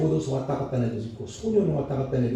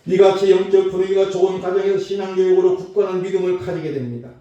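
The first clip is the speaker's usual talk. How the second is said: raised, loud sermon declamation; forceful preaching; 5 semitones higher, 6 dB louder than usual. Acted. Preacher urging families toward faith at home, loud and declamatory. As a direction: drive the point home in one sustained loud sweep.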